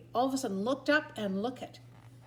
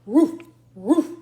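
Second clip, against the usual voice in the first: loud rough dog woofs; Muffin McClay woof; 3 semitones higher, 11 dB louder than usual. Acted. Dog in the bath barks loudly at the sound. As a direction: woof it like a big shaggy dog. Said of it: "said Muffin McClay".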